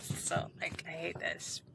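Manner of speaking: very soft, low grumble; muttered complaint. Retold or imitated. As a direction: drop to a near-whisper of disgust.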